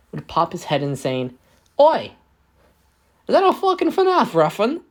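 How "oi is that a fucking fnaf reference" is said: high, loud mock British shout; British accent. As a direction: squawk it out in a cheeky British accent. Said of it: "Oh, wait, that's a British accent".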